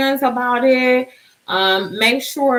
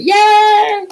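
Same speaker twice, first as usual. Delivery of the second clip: excited cheer; 10 semitones higher, 7 dB louder than usual. Real, not acted. Cheering her giveaway winners.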